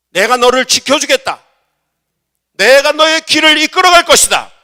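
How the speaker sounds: very loud, high divine declaration; thunderous voice of God in a sermon; God's booming promise. Acted. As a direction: proclaim each promise at full voice. God's promise to Jacob, acted.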